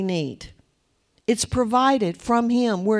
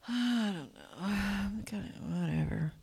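listless; 2 semitones lower, 11 dB quieter than usual. Retold or imitated. Voicing a lethargic, listless person.

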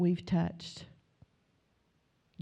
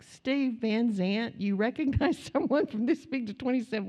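higher, laughing incredulity; amazed disbelief; 4 semitones higher than usual. Retold or imitated. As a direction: retell your astonishment, breaking into a laugh. Retells own amazement at Steve's recognition.

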